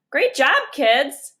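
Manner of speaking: slow call across the yard. Acted. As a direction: call out to the kids. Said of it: "called Hank's mom".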